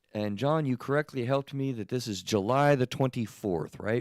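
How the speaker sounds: ordinary talk, nothing marked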